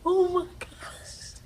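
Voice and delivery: high, breath-heavy whispered panic; panicked gasp. Retold or imitated. Rider retelling being stuck rocking at the top, panicked gasp. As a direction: gasp it out in mock dread, drawn out slowly.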